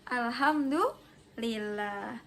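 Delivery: Alhamdulillah stretched out slowly, each syllable drawn long; slow group recitation. Shown as a demonstration. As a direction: lead the class, drawing out Alhamdulillah. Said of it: "we say together". (Alhamdulillah diucapkan pelan dan panjang bersama kelas)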